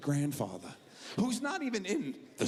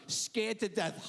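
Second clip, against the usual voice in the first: usual speaking voice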